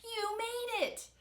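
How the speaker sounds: high, drawn-out cheer; cheering shout. A narrator's reading. Mom congratulates Victor on landing, cheering shout. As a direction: cheer slowly at high pitch. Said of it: "shouted Mom".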